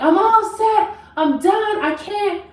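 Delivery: high-pitched imitation of tearful despair; mock boo-hoo wail. Retelling her meltdown in the Jeep, mock boo-hoo wail. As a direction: wail it high, acting out being done. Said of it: "I am boo-hoo crying".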